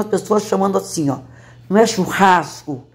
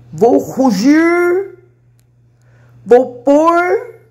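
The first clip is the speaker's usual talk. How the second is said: high, slow, rolling r on the verbs; stretched r verbs; 8 semitones higher, 3 dB louder, much slower than usual. Shown as a demonstration. Woman demoing rural r on verbs, slow and high. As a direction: draw out the r in "rugir" and "pôr". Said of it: "Os verbos falam assim".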